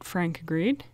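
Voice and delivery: ordinary delivery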